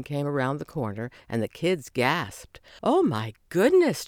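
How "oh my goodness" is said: 1 awed murmur. A narrator's reading. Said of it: "Chelsea murmured".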